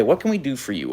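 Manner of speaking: ordinary delivery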